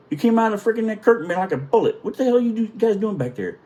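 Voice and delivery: high, quickening astonished questioning; retold incredulous chief. Chief asks about sailor flying through curtain, incredulous. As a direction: act out baffled, exasperated questioning.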